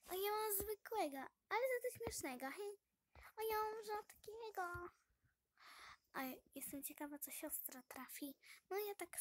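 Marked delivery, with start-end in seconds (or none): 0.1-4.9 playful boasting. Real, not acted. Showing off her own toy.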